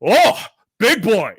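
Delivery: a hint of a cocky wrestler's drawl; Brock Lesnar. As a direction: lightly mimic a wrestler sizing up a rival. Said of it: "Brock Lesnar was all like".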